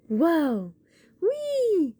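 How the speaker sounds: high, loud delighted cheers; excited cheers. Acted. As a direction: cheer over the presents. Beans cheering over gifts.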